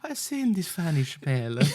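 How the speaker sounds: put-on joining the granny act, Italian accent; mock Italian nonna. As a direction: swoon like an old Italian lady. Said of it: "joining the nonna bit".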